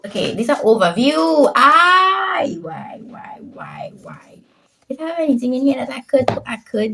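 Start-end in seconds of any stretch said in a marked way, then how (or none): 1.6-2.6 loud high wail of frustration; frustrated cry. Real, not acted. Frustrated outcry. 2.6-4.4 breathy whisper, why repeated; whispered despair. Real, not acted.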